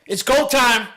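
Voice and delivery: high, loud burst of energy; hyped wake-up shout. Retold or imitated. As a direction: blast it down the phone to wake her up. Wake-up call to girlfriend.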